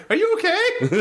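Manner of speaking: squeaky hammed-up quote; high mock voice. Host quotes a sound-check line from the show, laughing.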